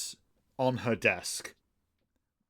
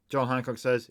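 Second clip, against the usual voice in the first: ordinary talk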